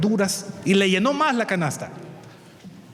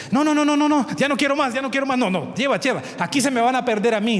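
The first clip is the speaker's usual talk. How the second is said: overwhelmed neighbor refusing more vegetables; flustered refusal; 9 semitones higher than usual. Acted. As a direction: wave off more gifts in a fluster.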